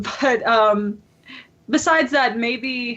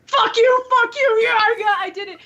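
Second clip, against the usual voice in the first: excited yelling; 11 semitones higher than usual. Retold or imitated. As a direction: celebrate beating the boss. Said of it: "he was like".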